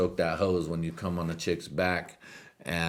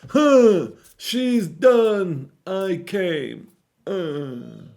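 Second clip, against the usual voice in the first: a lightly done Bill Clinton, pitched high; mock Clinton counting off; 12 semitones higher, 9 dB louder, much slower than usual. Counting out loud, a light Bill Clinton impression. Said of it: "sound like Bill Clinton".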